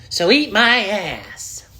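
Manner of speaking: slow, high odd put-on voice; slow, high goofy voice; weird voice. Real, not acted. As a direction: drag it out in a strange voice. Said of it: "I don't even know what voice that is".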